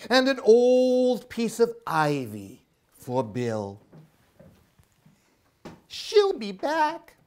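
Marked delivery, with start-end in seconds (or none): 5.9-7.2 put-on high, louder anxious child voice; big sister owl calling for Mommy; worried big sister owl. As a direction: ask for Mommy in a fretful high voice. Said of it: "said Sarah".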